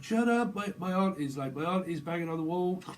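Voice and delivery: high, loud, worked-up complaint; mock agitated protest. Someone whose auntie's banging on the wall, mock agitated.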